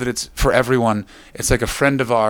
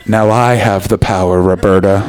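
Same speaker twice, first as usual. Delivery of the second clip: lower-pitched mock creep voice; hammed-up menace; 6 semitones lower than usual. Comic voicing man in flatmate's doorway, with mock menace. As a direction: play the naked flatmate taking control, lightly. Acts out flatmate lurking naked.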